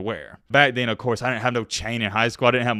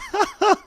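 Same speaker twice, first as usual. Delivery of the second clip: short high burst of real laughter; can't hold back a laugh at the clip. Real, not acted. Host cracking up at the player's clip, laughing.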